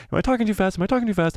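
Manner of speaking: high, rapid-fire repeated question; quick, squeaky self-mocking patter; talking too fast. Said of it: "I think I'm talking too fast".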